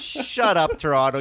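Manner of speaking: loud exasperated rant; shouting. Real, not acted. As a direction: yell it at the whole city. Ranting at Torontonians about cold.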